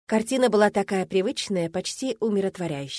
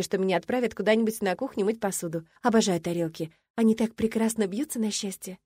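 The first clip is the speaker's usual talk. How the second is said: plain reading voice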